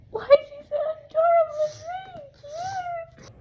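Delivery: overwhelmed adoring squeal. Real, not acted. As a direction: squeal over how cute he is. Fangirling over character.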